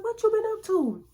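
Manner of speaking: very high, sing-song greeting; a high cheerful greeting from a close friend; friend's greeting. Retold or imitated. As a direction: lift it way up, playful and sweet. Other guy greets Tiffany.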